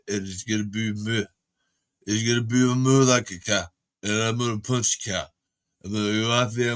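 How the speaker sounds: lightly voiced Stallone mumble; a hint of Rocky talking himself up; Sylvester Stallone. Streamer does a loose Stallone impression. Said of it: "That's Sylvester Stallone I do".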